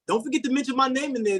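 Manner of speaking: ordinary talk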